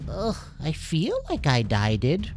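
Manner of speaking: groggy and achy; revived creature complaining of a sore belly; Dinky sore. Acted. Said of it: "Dinky groaned".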